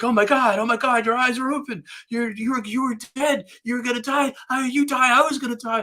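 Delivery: high-pitched frantic friend, retold; imitated screaming and crying. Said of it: "he's screaming and he's crying".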